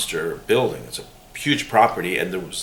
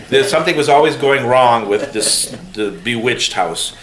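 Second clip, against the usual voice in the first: normal talking voice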